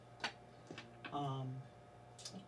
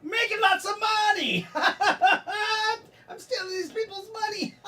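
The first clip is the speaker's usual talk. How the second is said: laughing, high, loud Scottish brogue; cackling Scottish character shout; Scottish accent; 14 semitones higher, 7 dB louder, slower than usual. Acted. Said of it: "I can't do my Scottish accent at all!".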